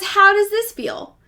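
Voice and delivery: lifted high, gentle question. Retold or imitated. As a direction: ask like a concerned lover. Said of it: "then says".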